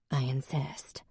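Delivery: soft unvoiced whisper; whispered insist. Emmeline leaving Jack, whispering sarcastically. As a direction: hiss it under the breath. Emmeline's curt exit line.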